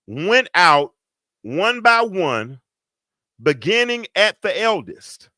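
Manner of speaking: solemn pulpit reading; scripture reading. Real, not acted. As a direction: read the verse with weight, word by word. Reads scripture in preaching voice.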